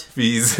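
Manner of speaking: Fizz said as fizz, like the drink; Fizz pronunciation. Shown as a demonstration. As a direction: stretch Fizz into a bubbly fizz. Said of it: "I'm sure I've used Fizz pronunciation".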